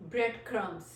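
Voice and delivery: ordinary talk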